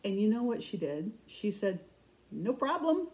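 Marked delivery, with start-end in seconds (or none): 2.3-3.1 light retold reply, lifted in pitch; breezy agreement. Seller agreeing to a customer's favor, breezy. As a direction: imitate her easy, higher-pitched yes.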